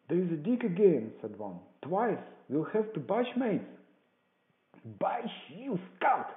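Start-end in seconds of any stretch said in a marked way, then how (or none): 0.1-1.1 high, loud warning; alarm call. A narrator's reading. Buccaneer warning his mates of danger, loud call. 1.8-3.7 high, raised warning voice; alarm call. A narrator's reading. Buccaneer urging his mates to flee, high loud warning. 5.0-6.3 high, quick outburst; Pew's scornful cry. A narrator's reading. Blind beggar scolding his cowardly man, angry cry. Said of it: "cried Pew".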